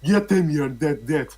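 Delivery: put-on high Jamaican accent. Reading Jamaican copypasta.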